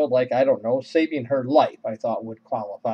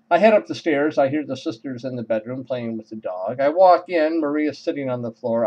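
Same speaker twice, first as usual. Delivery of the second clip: ordinary delivery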